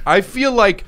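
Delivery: laughing through the words; giggling as she starts her point. Real, not acted. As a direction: breaks into laughter before speaking. Laughing at the joke.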